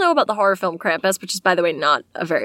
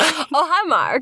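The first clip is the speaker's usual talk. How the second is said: higher-pitched comic impersonation; Tommy Wiseau; 8 semitones higher, slower than usual. Acted. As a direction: greet Mark in an odd put-on voice. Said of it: "Tommy Wiseau heard that Santa".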